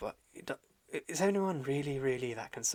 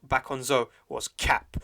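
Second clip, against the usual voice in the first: loud, emphatic mock taunt; trash-talking challenge; 3 semitones higher, 7 dB louder than usual. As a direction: call out an enemy, hammering the words. Acted trash-talking challenge to an enemy.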